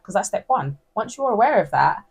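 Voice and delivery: normal talking voice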